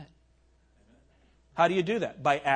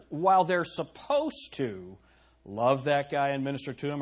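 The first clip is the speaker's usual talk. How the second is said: usual speaking voice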